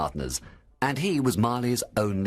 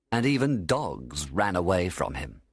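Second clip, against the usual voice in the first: ordinary delivery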